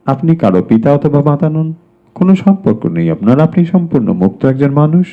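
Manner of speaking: ordinary talk, nothing marked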